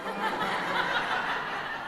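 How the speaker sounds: airless giggle up high; high breathy giggle. Real, not acted. Preacher laughing at his own joke, high breathy giggle.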